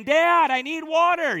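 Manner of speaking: put-on high, loud, slow child's yell. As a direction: drag out the call for dad. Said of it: "So he yells out again".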